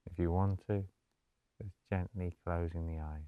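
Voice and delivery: soft, low-key meditation voice with long pauses; guided meditation. Acted. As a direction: gently guide them into stillness, hushed and unhurried.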